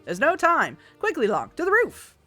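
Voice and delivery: put-on old Hollywood transatlantic accent; glamorous old hollywood star voice. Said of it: "a transatlantic accent that I've given him".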